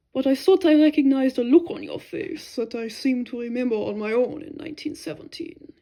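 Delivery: put-on old German monk, earnest and reflective, groping for a word; lightly voiced foreign monk, sincere confession fading to a hush. A narrator's reading. Monk opening up to the soldier about his war, sincere and searching.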